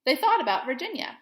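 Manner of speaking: gentle storybook reading voice; expressive picture-book read-aloud; reading to children. Acted. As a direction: read it like story time for young kids. Teacher reads picture book aloud.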